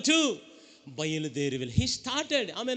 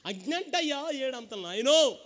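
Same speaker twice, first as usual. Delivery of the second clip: ordinary talk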